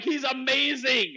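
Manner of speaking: loud, high, stretched-out rave; gushing enthusiasm. Retold or imitated. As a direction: rave about him at full tilt. Acting own gleeful reply.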